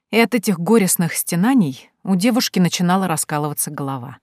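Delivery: usual speaking voice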